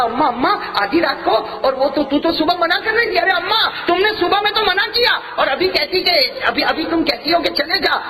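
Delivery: put-on high, urgent boy's protest; bewildered boy. Young son questioning his mother at midnight, high and urgent.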